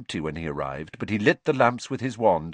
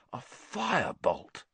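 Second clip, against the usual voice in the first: awed; 4 semitones higher, 6 dB quieter, slower than usual. Acted. Wood savours the word.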